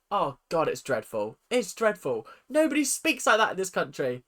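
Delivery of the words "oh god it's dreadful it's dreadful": ordinary talk, nothing marked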